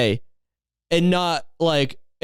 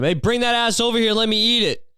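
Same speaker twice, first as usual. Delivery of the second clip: higher-pitched mock yell, crude and taunting; pretend yell; 6 semitones higher than usual. Vlogger imagines yelling back at the man who mooned him, pretend yell. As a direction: hurl a mocking yell across the street. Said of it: "Should've just yelled at him".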